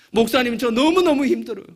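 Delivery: imitating a distressed plea. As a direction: act out the elder's heartbroken plea. Wife elder's plea quoted.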